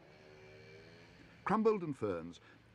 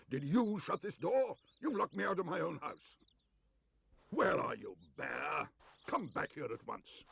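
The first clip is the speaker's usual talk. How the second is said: angry shouting; 7 semitones higher than usual. A narrator's reading. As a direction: rage at the bear. Locked-out neighbour raging.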